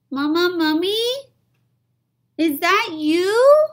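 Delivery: put-on high little-kid plea; squeaky begging little mummy; pleading child. Baby mummy calling out.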